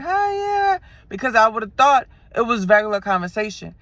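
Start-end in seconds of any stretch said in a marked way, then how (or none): 0.0-0.8 high bright friendly hi; old overly friendly me. Retold or imitated. Past self answering the driver, high eager greeting. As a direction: chirp it like you are eager to chat. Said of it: "Before, I would have been like".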